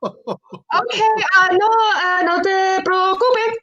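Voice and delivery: high, halting put-on politeness; acted flustered reassurance. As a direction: re-enact reassuring someone awkwardly in a high voice. Acts flustered reassurance to boyfriend's mother.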